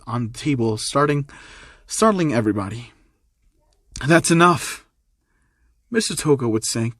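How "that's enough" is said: raised, slightly higher voice cutting in; mildly acted angry interruption; firm outburst. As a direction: put your foot down, loudly. Izuku slams table, interrupts argument.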